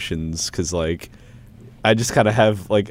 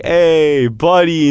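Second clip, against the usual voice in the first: high, loud mock-hearty hail; teasing call; 9 semitones higher, 6 dB louder, much slower than usual. Teasing a friend who hates buddy, loud and chummy. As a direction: needle a friend with chummy cheer.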